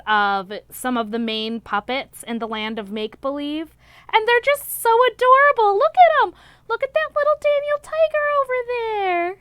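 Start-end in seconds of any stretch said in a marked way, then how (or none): 4.1-9.4 much higher, louder cooing gush; squealing with delight. Real, not acted. Showing viewers the puppet drawings, high squealing coo. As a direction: coo over how adorable they are.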